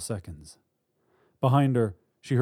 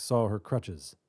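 ordinary delivery, nothing marked

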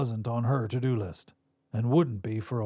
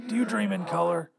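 higher-pitched raspy voice; 6 semitones higher than usual. A narrator's reading. Visitor wakes his past self, raspy. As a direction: rasp it out as an older double of yourself. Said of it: "though a bit raspy".

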